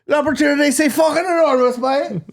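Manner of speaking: high mock Irish-accented French; Ronan O'Gara. Mocking a coach's French team speech, high Irish-accented impression. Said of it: "have you seen Ronan O'Gara".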